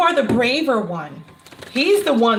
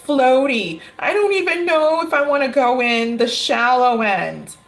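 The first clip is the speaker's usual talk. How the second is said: put-on timid kid voice. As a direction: play a nervous child dithering at the pool. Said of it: "And he's like".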